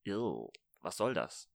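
slow, low, soft grossed-out groan; mock disgust. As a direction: act repulsed by getting old. Mock disgust at turning older.

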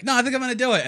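high, loud, giddy insistence; stubborn excitement. Retold or imitated. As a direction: announce it like a kid who won't listen. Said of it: "and then I'm like".

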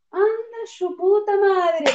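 high, slow stunned curse; retold exasperated exclamation; drawn-out exasperated exclamation. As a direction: stretch it out in stunned disbelief.

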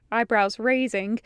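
ordinary delivery

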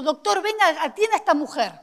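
loud, pressing summons; urgent call. Retold or imitated. Receptionist calling the doctor to help her, urgent. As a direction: call the doctor over right now.

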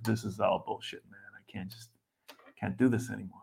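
deflated mock despair, dropping almost to nothing; weary inner mutter. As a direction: mutter it to yourself like you're done. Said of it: "You start getting these notions of".

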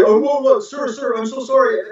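high, flustered backpedalling, voice jumping up; mock-frantic sorry to a superior; panicked apology. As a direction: imitate a man scrambling to apologise, pitched high and rushed. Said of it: "And he's like".

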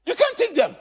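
high, loud cry of the same words; shouted repeat. Real, not acted. Pastor shouting the point at the congregation. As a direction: fling it at them.

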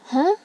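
"huh" going up like a question; rising tone. Shown as a demonstration. Teacher likening second tone to "huh", rising. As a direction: slide "huh" sharply upward. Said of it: "It is going up".

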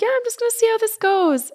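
high-pitched retold polite answer; imitating her own chirpy brush-off; cheery reply. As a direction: keep it light and way up high. Her bright reply to well-wishers.

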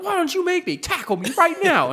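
very high, loud mock dare; imitated defiant challenge. Retelling his answer to being shushed, high mock defiance. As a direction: act out an angry man daring someone to fight. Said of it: "people were telling him to shut up".

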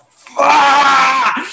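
a high, loud imitated curse from the wild woman; furious outburst. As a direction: let the inner wild woman explode. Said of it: "made that wild woman go".

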